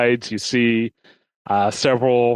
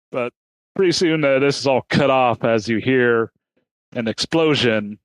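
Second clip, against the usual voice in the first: ordinary delivery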